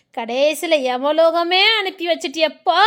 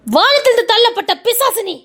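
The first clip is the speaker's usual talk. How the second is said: angry; 6 semitones higher, 4 dB louder than usual. Acted. Accusing the man as a devil.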